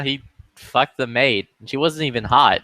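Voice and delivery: ordinary talk, nothing marked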